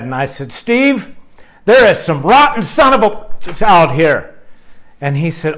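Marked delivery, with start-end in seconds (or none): 0.5-4.3 raised, louder, heated retelling of a rant; angry complaint. Retold or imitated. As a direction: fume at the stroller guy, half-performed. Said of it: "I was smoking mad".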